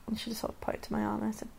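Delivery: ordinary delivery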